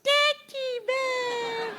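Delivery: drawn-out falsetto coo; slow, very high sing-song baby talk; mother cooing to baby. Acted. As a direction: dangle a toy in a squeaky stretched voice. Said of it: "The mother comes".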